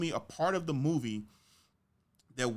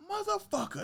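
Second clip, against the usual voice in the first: high-pitched retold curse of disbelief; stunned reaction. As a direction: curse way up high, imitating your shock. Acting out amazed audience reaction to death.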